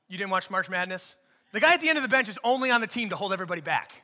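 ordinary delivery

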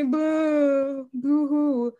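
hammed-up fake boo-hoo crying. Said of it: "manipulators love playing the victim role".

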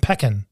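pecan as PECK-un; squashed E. Shown as a demonstration. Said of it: "sit down on that E".

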